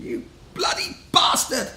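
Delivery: high, drawn-out outraged cry; imitated outrage. Man covered in coleslaw curses hooligan, imitated outrage. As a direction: imitate a furious victim, each word stretched.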